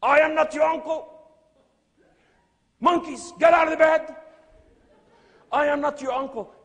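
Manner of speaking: put-on screaming at the children, high and loud; cri rejoué. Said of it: "I start screaming at them".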